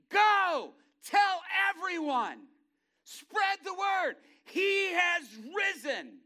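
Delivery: high, loud, slow ringing shout; triumphant angel crying out the news; angel's proclamation. Acted.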